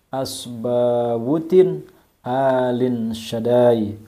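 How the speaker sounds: slow, measured Arabic prayer with long drawn vowels; slow recitation. Shown as a demonstration. Reading a prayer for viewers to write down, slow recitation. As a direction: recite phrase by phrase, pausing after each. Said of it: "Saya baca perlahan".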